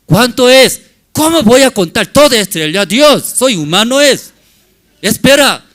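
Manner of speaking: loud, high protest at God; overwhelmed man throwing up his hands; Abraham crying out he cannot count the stars; exasperated Abraham. Acted.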